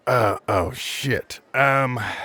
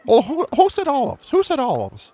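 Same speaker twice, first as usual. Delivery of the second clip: high calling character voice. Acted. Brass calls out.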